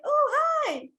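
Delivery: squeaky high, bright hello; high excited greeting. Shown as a demonstration. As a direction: say hi like you're delighted to see them. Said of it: "It's high tone".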